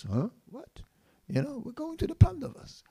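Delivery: high, startled question; baffled surprise. Retold or imitated. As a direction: Narada can't believe his ears. Narada baffled by refusal.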